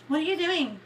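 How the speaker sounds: high mock-sister voice; imitating sister. Quoting sister's call to viewers, high mimicry.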